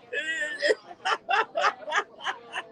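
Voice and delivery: a long real laugh at his own story. Real, not acted. Host laughing at the Benoit anecdote, a long laugh. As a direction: let the laugh roll out.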